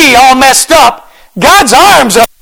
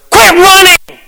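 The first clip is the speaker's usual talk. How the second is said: higher, urgent exhorting from the pulpit; fired-up preaching; 7 semitones higher than usual. Real, not acted. Pastor to the congregation, fired-up exhortation. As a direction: push them to stop running.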